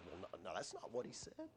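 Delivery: light, unsure protest; hesitant reply. Retold or imitated. Eve answers the serpent.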